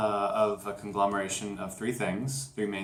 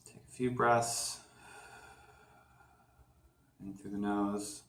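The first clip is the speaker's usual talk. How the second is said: guided meditation; 2 semitones lower, 6 dB quieter than usual. Acted. Host guiding listeners to feel gravity's pull, calm meditation voice. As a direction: lead them gently down into grounding, leaving room to breathe.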